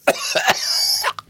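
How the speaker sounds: sharp coughs, choking; cough, choking on a drink. Real, not acted. Chokes while reading.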